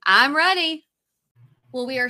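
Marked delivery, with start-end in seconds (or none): 0.0-0.8 higher, louder, eager I'm ready. Real, not acted. Answering co-host's cue to start, eager and louder.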